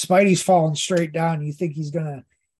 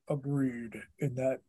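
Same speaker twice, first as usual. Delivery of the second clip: "agreed" said lower and much softer, a light cartoon Kingpin rumble; 5 semitones lower, 13 dB quieter than usual. Imitating the nineties cartoon Kingpin voice actor saying agreed, low and soft. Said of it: "I could hear the dude that did Kingpin's voice".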